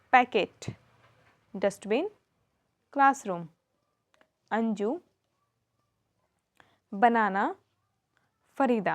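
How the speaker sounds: one word at a time, long pauses between; careful listen-and-repeat word list; dictation for repeating. Shown as a demonstration. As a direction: call out each word separately for children to repeat. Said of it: "उसे सुनो और repeat करो".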